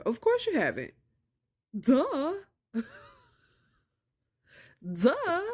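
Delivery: high mocking duh. Real, not acted. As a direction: scoff at the obvious. Mocking the obvious.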